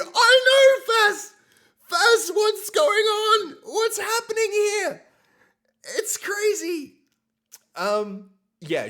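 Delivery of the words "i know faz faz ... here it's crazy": high, loud calling out; excited shout. Real, not acted. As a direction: shout to her in disbelief. Calling to sister over hype.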